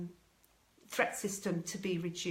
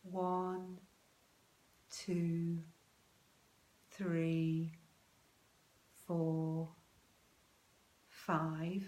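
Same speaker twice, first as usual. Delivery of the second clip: slow count; much slower than usual. Shown as a demonstration. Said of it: "to that count of five".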